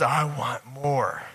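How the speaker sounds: low, soft, very slow longing; voice of the soul. Acted. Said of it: "your soul, your spirit comes in and says".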